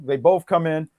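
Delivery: usual speaking voice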